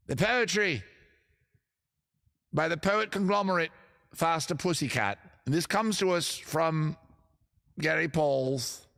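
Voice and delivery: hammed-up British poetry announcement; over-the-top British poet. Host introducing a hair metal poem, over-the-top British poet voice. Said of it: "the over-the-top British accent".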